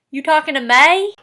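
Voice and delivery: sassy Southern question, rising on "May"; Southern accent. Shown as a demonstration. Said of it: "E becomes A".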